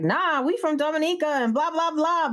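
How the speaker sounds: high indignant retort; cousin going off. Retold or imitated. Cousin goes off at island lady, high indignant protest. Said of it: "went off like".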